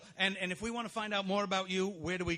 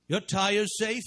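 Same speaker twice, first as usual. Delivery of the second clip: put-on dry question in a Maine accent; Maine humor voice; 3 semitones higher, 3 dB louder, much slower than usual. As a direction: ask it like a Maine local having fun. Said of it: "with a Maine humor kind of voice".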